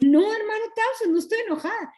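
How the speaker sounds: very high-pitched, defensive mock protest; protesting denial. Retelling her answer to hermano Townsend, high protesting denial. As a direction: deny it high, as if affronted. Re-enacts denying anger when confronted.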